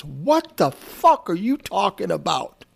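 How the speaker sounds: slow, disbelieving angry retort. Retold or imitated. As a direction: spell out the outrage slowly. Artist confronts the A and R guy.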